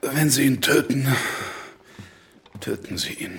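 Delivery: from sharp to breathless; cold verdict. Acted. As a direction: snap the condition, hush the consequence.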